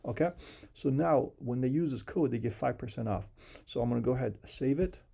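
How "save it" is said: ordinary delivery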